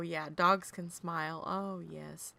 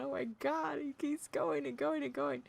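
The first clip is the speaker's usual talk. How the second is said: exasperated; 7 semitones higher than usual. Retold or imitated. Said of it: "We're sitting there going".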